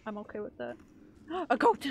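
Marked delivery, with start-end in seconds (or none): 1.3-1.9 excited gasp. Real, not acted. Sees goat in horror game.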